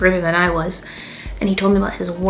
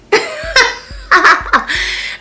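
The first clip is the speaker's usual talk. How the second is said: high burst of laughter; 4 dB louder than usual. Real, not acted. Laughing about being carded.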